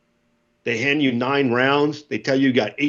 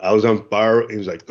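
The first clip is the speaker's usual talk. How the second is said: normal talking voice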